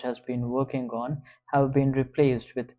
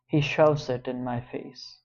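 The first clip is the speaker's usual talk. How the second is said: plain reading voice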